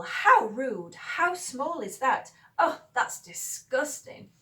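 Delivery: slow, loud indignant exclamations; appalled exclamation with big pitch swoops; exclaiming. Shown as a demonstration. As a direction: exclaim with drawn-out, mock outrage. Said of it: "If you're excla-, be-, exclaiming something".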